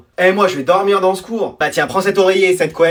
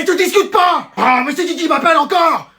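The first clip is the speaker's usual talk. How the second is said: high, irritated scolding; mother yelling; 7 semitones higher than usual. Acted. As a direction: snap at the interruption, pitched high. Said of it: "t'as fait que de me crier dessus". (mère excédée qui crie)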